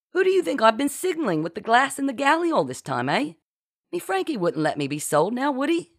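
high, swinging, colloquial boast; cocky boast. A narrator's reading. Genevieve brags about signalling her pirate lover, cockily. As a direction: toss the questions up cheekily.

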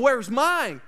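a hammed-up jealous "Where's mine?"; mock angry demand. Onlookers at the mall demanding money, indignant. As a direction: play the jealous crowd, indignant.

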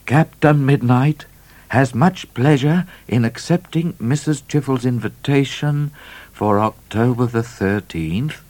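high, deliberate letter reading; formal reply. A narrator's reading. Midnight answering a dinner invitation warily, formal and measured.

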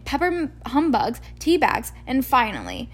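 ordinary delivery, nothing marked

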